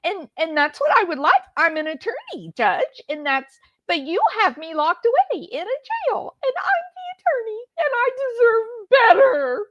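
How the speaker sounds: high-pitched, hammed-up tearful whine; mock whining. Mocking a jailed defendant complaining to the judge, whiny fake sobbing.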